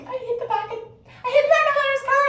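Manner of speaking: high whiny apologetic voice; mock-sad high confession; all sad. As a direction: squeak it out like a guilty kid. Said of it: "and I'm all sad".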